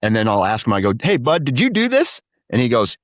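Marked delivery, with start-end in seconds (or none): 1.0-2.2 questioning son. Retold or imitated. Dad questioning son, retold.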